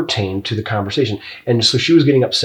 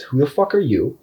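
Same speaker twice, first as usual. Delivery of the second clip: normal talking voice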